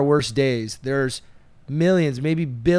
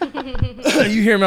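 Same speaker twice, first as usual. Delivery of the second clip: laughing; 11 semitones higher, 4 dB louder, much slower than usual. Real, not acted.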